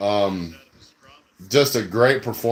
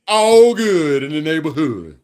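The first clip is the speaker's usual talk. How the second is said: slow, drawn-out victory crow; relieved celebration; 2 semitones higher, 4 dB louder, slower than usual. Real, not acted. Fan savoring the clinched win, slow celebratory drawl. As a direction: stretch it out in triumph.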